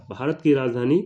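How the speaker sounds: ordinary delivery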